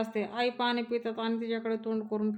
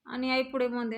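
ordinary delivery, nothing marked

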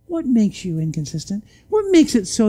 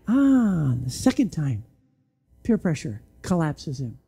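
ordinary talk, nothing marked

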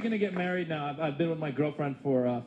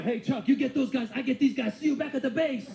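mock sidekick; 7 semitones higher than usual. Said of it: "We'll be like".

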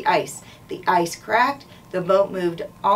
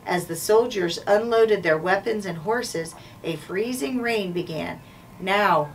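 ordinary delivery

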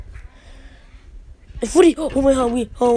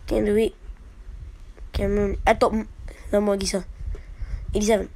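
usual speaking voice

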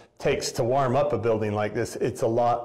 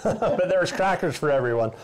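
laughing; 7 semitones higher than usual. Real, not acted. Joking after fallout shelter quip.

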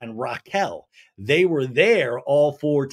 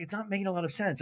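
flat Katie; 3 semitones higher, 9 dB quieter, faster than usual. Retold or imitated. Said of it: "Katie's like".

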